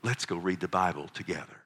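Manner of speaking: acted warm dad invitation; inviting father. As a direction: gently invite a child to join in. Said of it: "You say".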